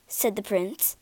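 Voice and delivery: ordinary delivery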